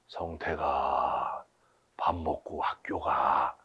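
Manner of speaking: put-on drawn-out low voice waking a sleepy boy; lightly voiced mother calling her son to breakfast; mock motherly wake-up call. Mimics mother waking dozing congregant.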